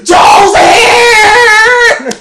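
imitating a gleeful scream; excited shout. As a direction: hail a favorite customer. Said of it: "she goes".

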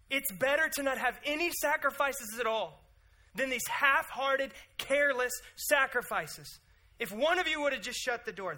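high, loud, pleading cry; God's urgent plea. Retold or imitated. God pleads with the priests, loud and urgent. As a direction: cry out that someone should shut the door. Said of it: "He goes".

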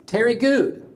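high put-on drunk voice; high, tipsy answer; drunk caller. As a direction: blurt the name, high and tipsy. Drunk caller gives name.

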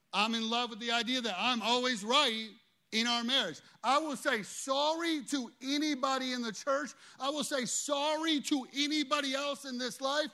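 loud, high preacher's cry; full-voiced sermon peak; preaching at full force. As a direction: preach it out with everything.

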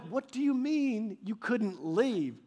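retold dumbfounded reaction; incredulous. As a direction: draw the question out in disbelief. Retelling his baffled reply to dad.

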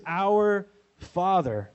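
slow, drawn-out reverent prayer; reverent prayer opening. Shown as a demonstration. Pastor showing disciples how Jesus taught prayer, slow and reverent. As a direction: pray it slowly like the first word to God. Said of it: "When you pray, say this".

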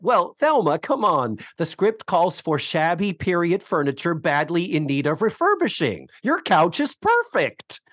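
lightly acted wheedling, peaking high on the last words; Iola pleading her case. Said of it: "Iola states her case that".

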